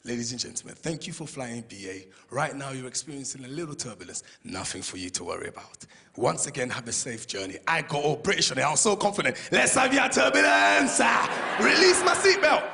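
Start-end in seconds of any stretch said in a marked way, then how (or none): 0.0-7.6 reassuring BA pilot. Retold or imitated. Pilot calming passengers in turbulence, low reassuring captain voice. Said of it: "that reassuring voice on B A". 9.5-12.6 slow, loud cocky declaration; mock bravado. Passenger taunting the turbulence, loud slow bravado.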